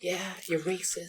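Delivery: high soft put-on woman. Defending his new voice to his friend, high and soft. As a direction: keep the girly voice going.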